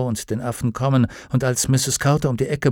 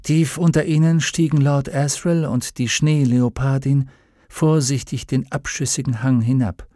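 plain reading voice

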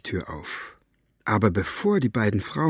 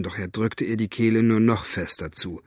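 ordinary delivery, nothing marked

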